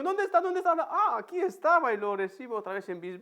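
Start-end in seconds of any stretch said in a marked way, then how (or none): 0.0-1.9 put-on high, laughing game voice for a toddler; playful peekaboo. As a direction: tease the child, giggling. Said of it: "y digo".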